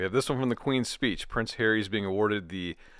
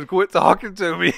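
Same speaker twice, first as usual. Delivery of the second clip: higher, louder through laughter; laughing outburst; 9 semitones higher, 9 dB louder than usual. Real, not acted. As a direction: blurt it while cracking up.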